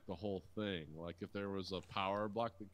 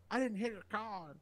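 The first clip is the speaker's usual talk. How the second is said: very high, louder mock protest; indignant denial; 13 semitones higher, 5 dB louder than usual. Driver denying the crash to police, high mock indignation. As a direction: imitate a driver's shrieky protest.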